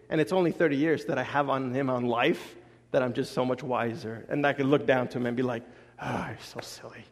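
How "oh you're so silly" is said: soft whisper, fond and patronising. Retold or imitated. Father looking down at his son, whispering fondly. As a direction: whisper it down to a little kid.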